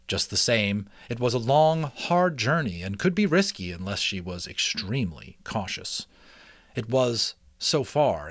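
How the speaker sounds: steady, lively read-aloud narration; story narration. A narrator's reading. Reading a mouse adventure to listeners, storytelling voice. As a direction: read it like a chapter book to listeners. Reads chapter book aloud.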